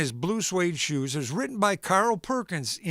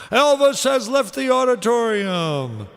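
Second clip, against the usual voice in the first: loud, slow, raised announcement; drawn-out stage sign-off; announcer sign-off; 5 semitones higher, 5 dB louder than usual. Acted. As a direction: close the show like a stage announcer. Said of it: "closing announcement".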